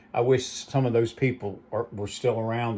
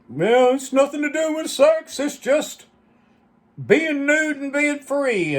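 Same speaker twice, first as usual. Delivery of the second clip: high, droning mock voice, drawn out at the end; Art; 14 semitones higher, 4 dB louder than usual. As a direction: imitate him in a high-pitched monotone drone. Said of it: "Art would get on there and said".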